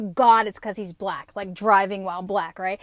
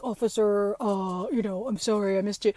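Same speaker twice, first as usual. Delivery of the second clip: sheepish ex-husband; 3 semitones lower than usual. Retold or imitated. Imitating ex-husband to officer.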